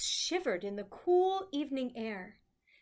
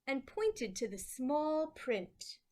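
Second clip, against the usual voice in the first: ordinary delivery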